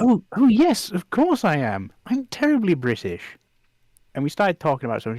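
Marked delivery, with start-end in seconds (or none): 0.0-3.2 put-on high, plummy King's English accent; hammed-up upper-class English accent; posh King's English. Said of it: "I switched to the, the King's English".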